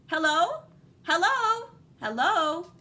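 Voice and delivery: loud hopeful hellos into the dark; rising, hopeful yelling hellos; hopeful calling out. Acted. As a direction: call out as if across an empty planet. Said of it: "he yelled a couple of hellos, hopefully".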